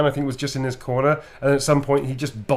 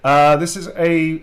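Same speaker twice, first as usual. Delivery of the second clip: ordinary talk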